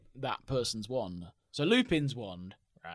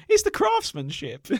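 much higher, squeaky, mock-tearful; mock emotional squeak; 6 dB louder than usual. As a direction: squeak it out, pretending to be moved. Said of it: "as I said to Liz at the time".

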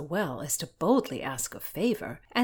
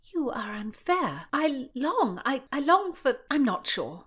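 higher, faltering confession; flustered protest; 8 semitones higher than usual. A narrator's reading. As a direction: stumble over the longing, then give up. Flustered Constance stammers her longing.

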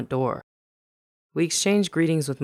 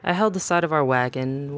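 plain reading voice

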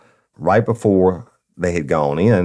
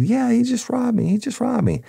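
much higher, tearful imitated victim; crying. Robbed clerk crying to the customer, imitated sobbing. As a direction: voice the clerk's upset, retold. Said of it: "comes out and she's crying".

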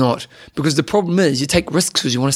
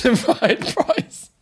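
slow, high-pitched teasing repeat; mocking echo; 9 semitones higher, much slower than usual. Co-host teasing his evasive price answer, mocking echo. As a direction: draw it out, amused, imitating him.

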